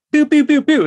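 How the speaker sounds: high, loud pew pew noises; laser sound effect. Retold or imitated. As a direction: zap like a video game blaster. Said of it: "it's like".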